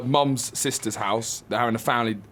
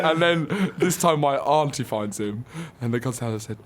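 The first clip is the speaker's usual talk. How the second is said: normal talking voice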